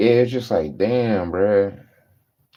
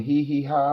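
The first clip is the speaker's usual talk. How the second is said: high mocking hee hee ha; a put-on silly titter; mock giggle; 6 semitones higher, 4 dB quieter than usual. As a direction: imitate lazy coworkers giggling. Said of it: "laughing, giggling".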